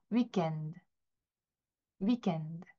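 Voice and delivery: 위켄드 read as a soft demo; French weekend. Shown as a demonstration. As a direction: model 위켄드 quietly the French way. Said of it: "같이 한번 읽어볼게요".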